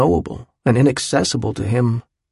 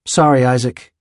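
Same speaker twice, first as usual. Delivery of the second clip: firm. A narrator's reading. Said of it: "he'd said firmly".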